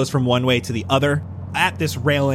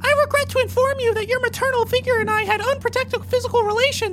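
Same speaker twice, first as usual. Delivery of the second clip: high, prim tiny cake; prim cupcake voice. Acted. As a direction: announce it like a polite little cupcake.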